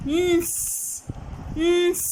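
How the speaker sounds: न्स said as one blended sound. Shown as a demonstration. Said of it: "अगर हम इन तीनों को ब्लेंड करें".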